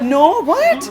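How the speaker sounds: high, loud shocked laugh; surprised. Retold or imitated.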